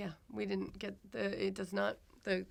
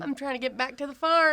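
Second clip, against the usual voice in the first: loud, higher country bumpkin twang; country bumpkin accent; 6 semitones higher, 8 dB louder than usual. Acted. Homesick Ohioan guest jokes in London, country bumpkin accent. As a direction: put on a playful bumpkin accent. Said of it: "I feel like a country bumpkin".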